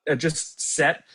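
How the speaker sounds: normal talking voice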